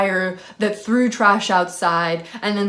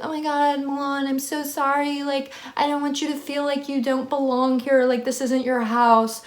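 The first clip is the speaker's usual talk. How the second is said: higher, gushing imitation of an apology; mock apologetic roommate; 5 semitones higher than usual. Roommate apologizing to the speaker, in a high mock-sorry voice. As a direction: play the over-sorry roommate, pitched up. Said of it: "She ended up apologizing".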